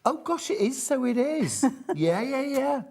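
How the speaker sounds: high delighted surprise; surprised laughing. Real, not acted. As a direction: squeal in amused surprise. Surprised at shirt likeness.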